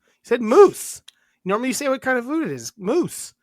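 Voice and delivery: retelling the cat food mix-up, exasperated. Retold or imitated. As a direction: protest like you were tricked.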